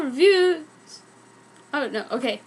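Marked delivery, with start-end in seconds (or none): none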